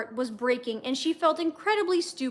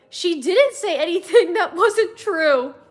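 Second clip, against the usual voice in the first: slow, loud sharp retort; snapping; 5 semitones higher, 7 dB louder than usual. A narrator's reading. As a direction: snap back at her in anger. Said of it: "Chan snapped".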